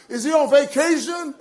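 high, loud mock question; mocking taunt. Elijah ridiculing the prophets' absent god, mocking. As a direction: jeer with exaggerated disbelief.

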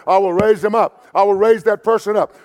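loud, emphatic declaration, RAISE stressed. Retold or imitated. Preacher quotes Jesus's promise to the congregation, emphatic. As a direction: declare it with confidence, punching "raise". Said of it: "Jesus says that emphatically".

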